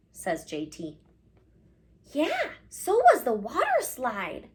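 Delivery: put-on gentle storybook read-aloud to kids; reading to children. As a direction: read it like story time in class. Reads picture book aloud.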